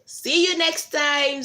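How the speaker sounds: ordinary talk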